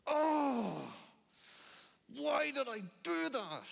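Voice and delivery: high, drawn-out wail of regret; hammed-up strained lament; mock anguished regret. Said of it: "realize that you've made a big mistake".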